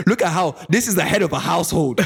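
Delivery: mock Ghanaian scolding. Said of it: "She said".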